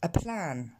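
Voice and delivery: "A plan" with a puff of air after the p; p aspiré. Shown as a demonstration. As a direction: model English "A plan", exploded p. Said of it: "je vous invite à répéter derrière moi". (« A plan » avec un p soufflé)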